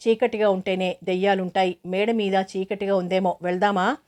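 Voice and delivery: ordinary delivery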